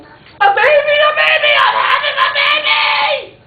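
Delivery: high, full-volume panicked mother's yell; frantic high-pitched holler. A narrator's reading. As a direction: shriek it out at the top of your voice. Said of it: "woke up and yelled".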